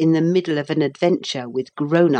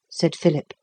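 ordinary delivery, nothing marked

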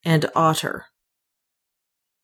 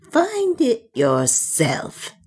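higher, slow, spat-out defiance; snarling; 6 semitones higher, slower than usual. A narrator's reading. Soa defies Ulfan with a slow, high snarl. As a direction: bite every word at him. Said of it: "snarled Soa".

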